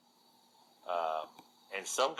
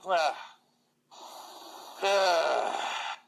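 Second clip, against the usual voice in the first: drawn-out higher ah, ah; necromancer's throat clearing; 8 semitones higher than usual. Acted. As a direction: clear the throat like an oily envoy.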